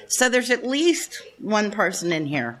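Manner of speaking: ordinary delivery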